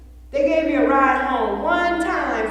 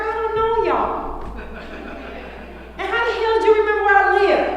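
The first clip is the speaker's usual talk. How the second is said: half-joking, suspicious disbelief; mock suspicion; 9 semitones higher than usual. Answering the persistent friend with high, mock-incredulous questions.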